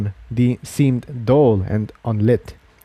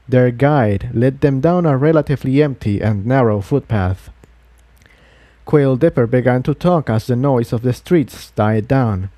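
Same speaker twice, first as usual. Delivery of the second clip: plain reading voice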